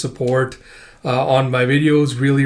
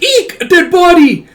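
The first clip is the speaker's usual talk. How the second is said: very high, loud squeal of fright; horrified shriek; 10 dB louder than usual. Acted. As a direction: shriek like a girl finding a corpse. Girl bumps into a corpse.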